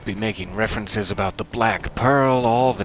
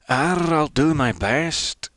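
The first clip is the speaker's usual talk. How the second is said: slow, higher and louder pirate growl with a rising end; 6 semitones higher, 6 dB louder, slower than usual. Shown as a demonstration. Streamer slips from Scottish into a slow, loud, hammed-up pirate. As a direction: ham it up like a pirate. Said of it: "I'm becoming a pirate".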